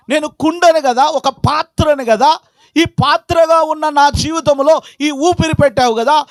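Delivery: high-pitched pleading prayer voice; impassioned preaching. Acted. Preacher voicing a believer's prayer to the Lord, impassioned. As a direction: cry out to God from the pulpit.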